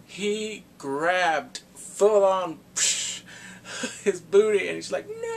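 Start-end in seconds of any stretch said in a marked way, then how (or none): 5.1-5.4 high mock squeal. He grabs his booty.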